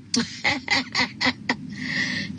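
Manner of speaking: high, airy staccato laugh; laughter. Real, not acted. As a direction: laugh in a long high string. Laughing at haters' comments.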